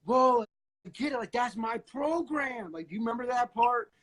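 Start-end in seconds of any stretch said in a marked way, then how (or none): 0.1-2.7 high, startled realisation; imitating a man who has just had a revelation; freaked-out amazement. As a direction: gasp it out like it just clicked. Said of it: "freaked out".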